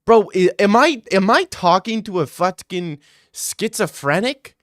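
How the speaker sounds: loud, high incredulous outburst. Real, not acted. Streamer reacting to a rude viewer comment, incredulous. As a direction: blurt it out in disbelief at the comment.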